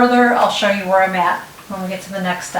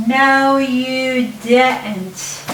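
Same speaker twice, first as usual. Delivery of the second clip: mock scolding; 4 semitones higher, much slower than usual. Mock scolding at a staple slip.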